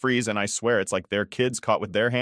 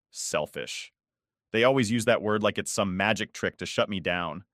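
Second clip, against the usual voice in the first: ordinary delivery, nothing marked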